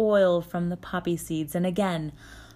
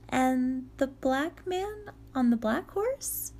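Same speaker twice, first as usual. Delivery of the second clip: put-on young girl voice; 7 semitones higher, slower than usual. Vasilisa questioning.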